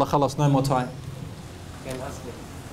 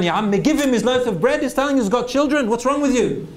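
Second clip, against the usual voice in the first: higher, indignant rebuke; stern plea to the wealthy man, higher; righteous anger; 7 semitones higher than usual. Retold or imitated. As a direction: demand it with good anger. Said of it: "the person who's angry. And this is good anger".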